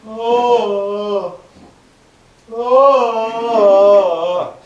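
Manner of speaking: a loud, rising bored yawn. Shown as a demonstration. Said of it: "the yawning of shogi".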